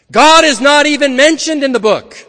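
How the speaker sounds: emphatic preaching. Acted. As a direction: proclaim it like a revelation to the congregation. Preacher drives home key point.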